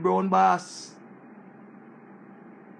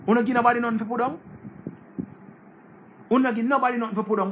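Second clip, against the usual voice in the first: fast, pushed higher, mocking disbelief; incredulous retort; 5 semitones higher, much faster than usual. Pressing the ungrateful friend again, fast rising disbelief. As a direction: hammer the question home, quicker each time.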